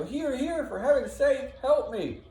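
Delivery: very high, pleading cry; yelling for help. Acted. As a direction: beg urgently as the wounded man. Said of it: "hear him off in the distance yelling".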